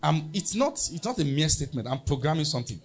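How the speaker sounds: ordinary talk, nothing marked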